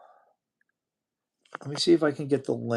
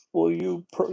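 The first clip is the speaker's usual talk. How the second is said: ordinary talk, nothing marked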